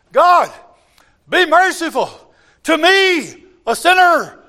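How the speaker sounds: high, loud desperate plea to God; crying out. Acted. Publican begging God for mercy, loud high cry. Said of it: "That's crying out".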